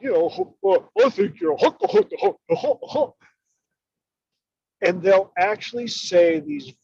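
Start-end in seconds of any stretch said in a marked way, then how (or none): 0.0-3.1 high goofy cartoon voice with hyuck-like stammer; silly cartoon dog stammering. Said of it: "using the voice of Goofy".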